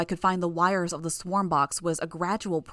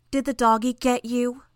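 high, louder mocking question; growled taunt; 6 semitones higher, 4 dB louder than usual. A narrator's reading. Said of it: "Imp growled the question".

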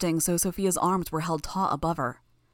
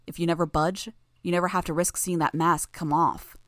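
plain reading voice

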